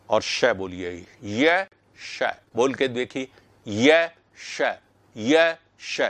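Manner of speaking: teacher models श with the tongue at the palate; palatal sh demo. Shown as a demonstration. Teacher shows the class how to say श. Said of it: "वहीं पर जीभ को ले जा करके".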